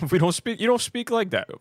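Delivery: high, loud mock British protest. Brit objecting to being mimicked, hammed-up mock British. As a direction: object in an exaggerated British accent. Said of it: "make fun of us for speaking like that".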